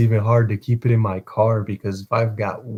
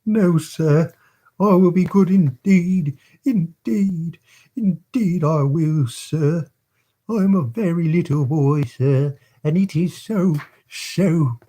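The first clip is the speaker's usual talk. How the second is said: put-on high, pleading child voice; tearful child; 7 semitones higher than usual. A narrator's reading. Orphan begs Bumble not to be cross, high and tearful. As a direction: plead like a frightened little boy. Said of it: "cried the child".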